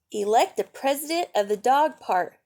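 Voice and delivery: ordinary delivery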